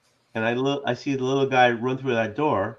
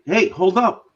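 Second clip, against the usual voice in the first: higher, louder yell; 7 semitones higher, 6 dB louder, slower than usual. Pretend. Player yelling at a fleeing kid, in-game yell. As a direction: call out to stop him. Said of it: "Can I yell at him?".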